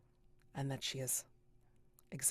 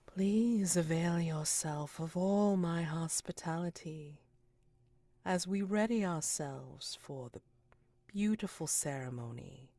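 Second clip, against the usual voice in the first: grand, drawn-out proclamation, swelling then easing slower; echoing villainess Titania. Acted. Titania's echo addresses the guests after vanishing, grand and drawn out. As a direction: stretch the words out like a ceremonial host foretelling doom. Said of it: "echo in her voice saying".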